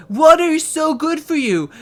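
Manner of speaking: high, loud mocking ad read; sing-song ad parody. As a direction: parody a cheery water commercial. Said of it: "commercial ... that is like".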